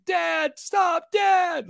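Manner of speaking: very high imitated kid's cry; tantruming child. Daughter being scooped up and carried out, high protesting cry. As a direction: mimic a small child shrieking in protest. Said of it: "she's like".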